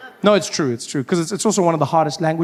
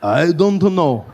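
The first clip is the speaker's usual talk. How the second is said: put-on Japanese accent; slower than usual.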